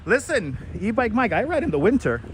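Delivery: ordinary delivery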